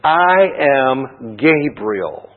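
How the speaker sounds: I am Gabriel said very slowly, each word weighted; slow, stately angel's announcement; emphatic I am. Shown as a demonstration. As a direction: proclaim it slowly and solemnly. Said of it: "same words emphasized in the Greek text".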